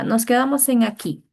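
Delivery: normal talking voice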